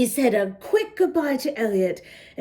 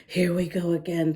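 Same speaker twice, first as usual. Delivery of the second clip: lower, fast, resigned grumble to himself; weary thought; 8 semitones lower, faster than usual. A narrator's reading. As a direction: roll your eyes inwardly. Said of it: "thought Zeke".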